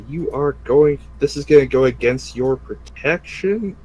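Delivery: normal talking voice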